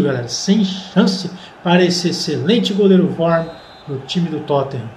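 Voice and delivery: ordinary talk, nothing marked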